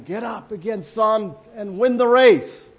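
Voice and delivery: building to a loud, slow call on "win the race"; father calling out. Retold or imitated. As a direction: cheer your son on from the grandstands, rising as you go. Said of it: "he heard his father's voice in the crowd".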